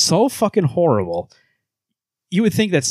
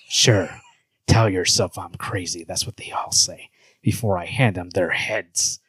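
low, slow, menacing hero voice; Batman; 6 semitones lower, slower than usual. Acted. Host answering Superman, low slow Batman voice. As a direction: keep it low and deliberate, ominous. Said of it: "low voice for Batman".

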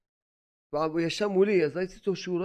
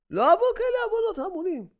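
high, strained cry; voicing the officer's vow to stop sinning; anguished vow; 3 dB louder than usual. Officer to rabbi, swearing off sin in a high strained cry. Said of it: "לא אחטא לפני השם".

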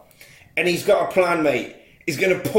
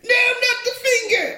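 very high mock damsel plea; hammed-up high pleading woman. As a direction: beg in a high squeal. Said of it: "she pleads".

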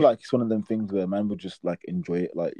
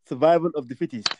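normal talking voice

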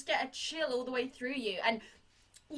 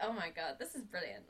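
quiet delight; 9 semitones lower, 11 dB quieter than usual. Retold or imitated. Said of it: "You're like".

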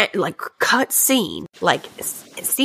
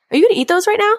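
very high, louder imitated disbelief; mock incredulous question; 14 semitones higher, 5 dB louder, much faster than usual. Man questions the woman about eating cuties, imitated high-pitched incredulity. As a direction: squeak it out like a judgmental question.

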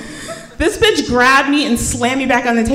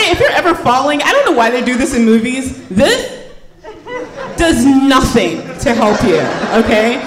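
normal talking voice

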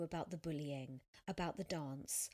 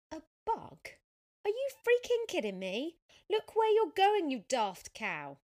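much higher, much louder outraged shouting; furious yelling; 10 semitones higher, 11 dB louder than usual. Acted. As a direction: blast her with disbelief and insults. Cyclist yells insults at Sophia.

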